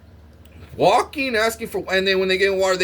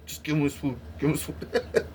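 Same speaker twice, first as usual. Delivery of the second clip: softer, hushed urgent plea; 8 dB quieter than usual. Retold or imitated. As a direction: beg them quietly to feed him. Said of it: "the nurse is like".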